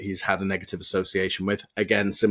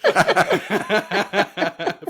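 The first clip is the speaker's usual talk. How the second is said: high, louder laugh dying down; real laughter; 12 semitones higher, 5 dB louder than usual. Real, not acted. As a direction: laugh at your own joke. OnlyFans joke.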